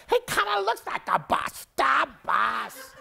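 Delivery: high, loud squawking broadcaster shout; Myron Cope. Retold or imitated. Player imitating the broadcaster who coined his nickname, high hammed-up shout. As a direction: shout it high, then punch the last two words. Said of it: "that's what he sounded like".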